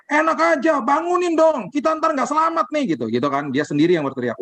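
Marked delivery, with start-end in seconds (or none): 0.1-3.0 panicked yell. Retold or imitated. Weak-faith member yelling to wake Jesus, high panicked cry. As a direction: yell it like a scared churchgoer.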